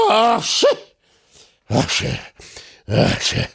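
sneezes dying from high to unvoiced; acted sneezing. A narrator's reading. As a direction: let out three mock sneezes, each weaker. Bear sneezes, caught a cold.

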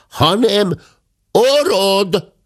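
very slow, loud, high shout; shouted into the ear. A narrator's reading. Servant bellowing into the deaf guest's ear, slow shout. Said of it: "fülébe ordította".

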